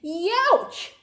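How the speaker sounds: high, loud yelp of pain; pained howl. A narrator's reading. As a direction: howl it out like a hurt little dinosaur. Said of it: "howled Tiny".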